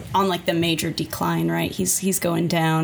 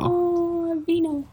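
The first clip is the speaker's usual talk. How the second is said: high, awed oh vino; pitched-up amazed repeat; delighted echo; 10 semitones higher, much slower than usual. Retold or imitated. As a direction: marvel at the punchline. Echoes Larry's joke, delighted.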